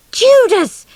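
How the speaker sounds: high furious shriek. A narrator's reading. Child shrieking at the eavesdropper. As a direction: shriek it at the traitor. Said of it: "shrieked poor baby".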